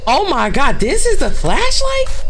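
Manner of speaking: surprised. Real, not acted. Shocked by the game's flashlight.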